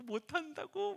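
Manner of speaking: a mock child's frightened protest; imitating scared child. Frightened son on the rope course, imitated whine.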